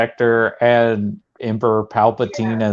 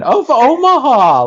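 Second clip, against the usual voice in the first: mock grand introduction; 13 semitones higher, 4 dB louder than usual. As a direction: sing out the title in imitation. Quoting film character's grand introduction.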